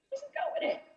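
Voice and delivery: high, soft singsong repeat; airy high-pitched echo of the line; mock sing-song.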